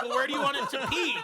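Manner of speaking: high, loud, laughing question; mock forum reply. Forum user replying to a player, high and laughing. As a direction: read the reply in a silly high voice. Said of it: "They're like".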